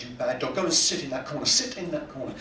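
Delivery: low, stern mock telling-off; mild dog scolding.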